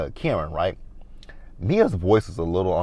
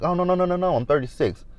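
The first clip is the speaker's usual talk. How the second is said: high, rattled-off string of noes; mock insistent correction; mimicked denial; 8 semitones higher than usual. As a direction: imitate her waving off the correction, pitched up. Mimicking castmate's repeated denial.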